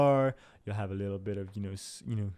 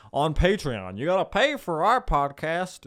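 higher, louder mock podcaster pitch; mock sales demand; 5 semitones higher, 5 dB louder than usual. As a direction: mimic pushy podcasters begging for money. Mimics podcasters demanding payment.

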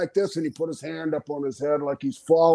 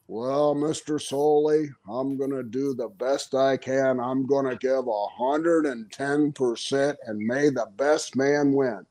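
put-on boring babyface promo; 5 semitones lower than usual. Wrestler answering the interviewer, boring and earnest. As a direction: plod politely through an earnest babyface promo. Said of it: "those same boring ass promos".

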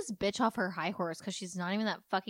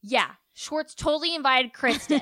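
loud, higher blurted accusation; mock blame-shifting; 5 semitones higher, 9 dB louder than usual. Podcaster playing Sandoval shifting blame onto Schwartz, loud and exaggerated. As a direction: throw him under the bus, loudly.

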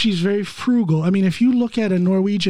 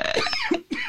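sudden choking cough; coughing on coffee gone down wrong; 8 dB quieter than usual. Real, not acted. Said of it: "Choking on his coffee there".

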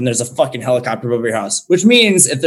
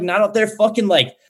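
ordinary delivery

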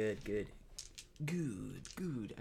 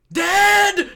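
high, loud mock-menacing yell; shouted punchline; 15 dB louder than usual. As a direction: bark it out as the punchline. First take of joke.

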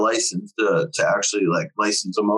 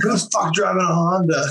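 high, raised mock shout at the intruder; mock yell; 8 semitones higher than usual. Biker at the party yelling about the Honda, mock shout. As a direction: bark it like a drunk biker spotting a Honda. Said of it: "It's like".

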